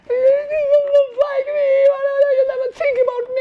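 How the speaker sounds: put-on high, loud wailing child's sob; crying child. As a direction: wail it like a hurt boy. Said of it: "I started to cry also".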